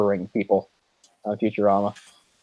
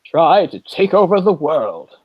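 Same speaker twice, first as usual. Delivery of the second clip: slow, higher, theatrical cartoon villain impression; the Brain; 6 semitones higher, 4 dB louder, slower than usual. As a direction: proclaim it slowly in an amateur cartoon-mastermind voice. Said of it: "That's my best impression".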